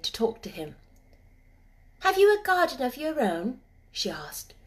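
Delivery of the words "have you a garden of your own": put-on higher girl's voice, eager; a child pointing out the bird; lightly voiced girl. Mary spotting the robin for Ben, eager girl's voice.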